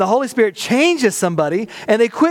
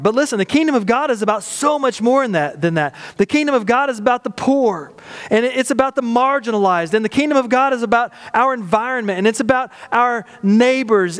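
higher, rising sermon build; preaching; 5 semitones higher than usual. Real, not acted. Pastor rallying the church, preaching fervently. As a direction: preach it with fervour.

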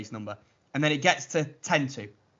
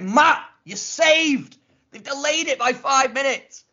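much higher, relieved and thrilled; elated; 11 semitones higher, 3 dB louder, slower than usual. Retold or imitated. Telling Matt on the phone he's saved, elated. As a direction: shout the good news.

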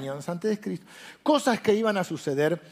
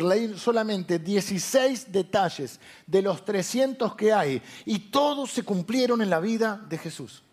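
ordinary talk, nothing marked

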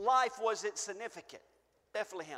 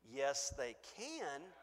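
quiet rebuttal; 9 semitones lower, 10 dB quieter, slower than usual. Acted. Calm answer to the objector.

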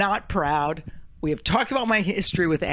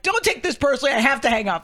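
retold screaming; 6 semitones higher, faster than usual. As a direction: re-enact a hurried phone yell, toned down. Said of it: "But I'm screaming".

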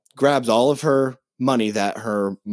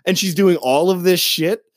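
higher, incredulous rant; mock outraged reviewers; 8 semitones higher than usual. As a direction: imitate the reviewers in disbelief. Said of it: "people were like".

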